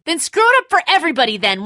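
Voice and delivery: high, loud, fast snap; angry outburst. Acted. Father lashing out at Lee in a fast angry outburst. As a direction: lash out in a rush.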